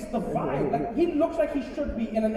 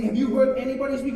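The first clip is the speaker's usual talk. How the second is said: ordinary delivery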